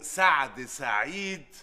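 name read out very slowly, word by word; slow, deliberate reveal of a full name. Acted. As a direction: draw out each part of the name. Reading out a name from a passport.

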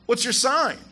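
mock woman. Woman asks Candace her sign.

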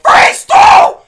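loud shouted level title; reading the exclamation-marked level name, screamed. Real, not acted. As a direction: belt the name out like an announcement. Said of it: "so I had to scream it".

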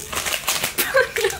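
giddy laugh. Real, not acted. Overjoyed at gift.

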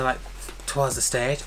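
usual speaking voice

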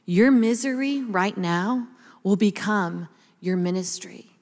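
slow, hushed motherly assurance; gentle solemn encouragement. Retold or imitated. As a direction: speak it slowly, letting each phrase settle. Mother's prophetic comfort retold.

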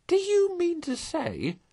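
very high, drawn-out disbelief; incredulous, high and stretched; excited cry. A narrator's reading. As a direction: cry it slowly at the top of the voice's range. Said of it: "cried the excited rat".

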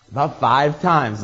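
ordinary talk, nothing marked

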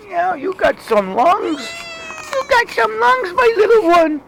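very high, loud squeaky pet cooing. Real, not acted. Owner gushing to her cat in squeaky baby talk. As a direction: coo up high like talking to a kitten.

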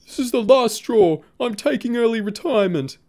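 high, loud hammed-up wail of a teacher at the end of her rope; mock crying teacher. Boy imitating his crying teacher to a friend, high and loud mock wail. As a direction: wail it like a teacher who has had enough, overdone. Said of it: "she was crying and going".

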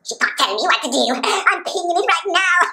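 mock kid in the pool; whiny kid. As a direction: brag like a naughty child. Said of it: "I'd be all like".

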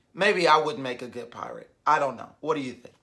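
ordinary delivery, nothing marked

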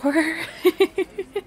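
loud high nervous giggle; nervous laugh. Real, not acted. Nervous laugh about telling her partner's parents. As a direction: laugh off the nerves.

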